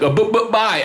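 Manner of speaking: high, teasing stammered goodbye; mocking stutter. Comic taunting the departing couple, mock stutter. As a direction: stumble on B on purpose to taunt.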